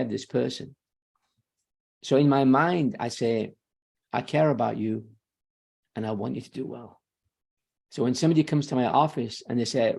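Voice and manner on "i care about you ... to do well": low, soft, tender reassurance; quiet, heartfelt inner voice, fading softer; sincere care. Acted. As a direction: lower the voice and mean it kindly. Voicing his caring inner intention.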